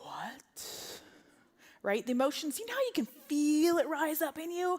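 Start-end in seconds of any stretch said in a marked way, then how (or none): 0.0-1.0 low, voiceless disbelief; barely audible, drawn-out question; stunned whisper. Retold or imitated. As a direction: breathe it out in shock. Stunned reaction to missing names.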